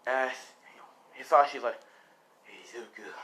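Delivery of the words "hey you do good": barely audible whisper; dying whisper. Acted. Dying father to his son, faint whisper. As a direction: whisper it with little breath left.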